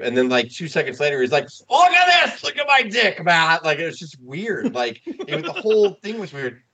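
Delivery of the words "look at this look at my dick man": high, loud hammed-up yell as Piper; mock Piper yell. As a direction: bellow it like a wild wrestler showing off. Retold Piper yelling on show.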